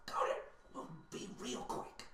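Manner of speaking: hushed, voiceless whisper; whispered reply. Acted. Comrade agreeing to be real quick, whispered. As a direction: whisper it conspiratorially.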